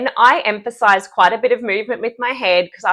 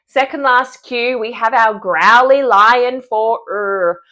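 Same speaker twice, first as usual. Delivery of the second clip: usual speaking voice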